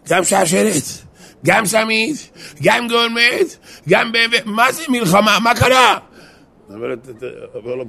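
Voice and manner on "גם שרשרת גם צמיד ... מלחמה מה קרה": high, loud, mounting disbelief; astonished outcry. Acted. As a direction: repeat the list in mock shock, building to an outburst. Other husband astonished at the gifts. (תדהמה מוגזמת, בצעקה גבוהה)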